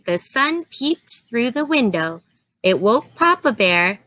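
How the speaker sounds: plain reading voice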